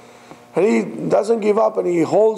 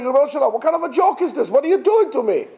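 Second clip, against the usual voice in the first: high, outraged disbelief; indignant disbelief; 9 semitones higher, faster than usual. Retold or imitated. Climber protests God's demand, high and outraged. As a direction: protest a cruel joke. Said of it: "He's like".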